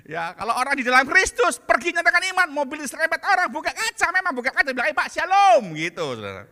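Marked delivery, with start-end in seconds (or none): 4.9-5.7 polite greeting. Retold or imitated.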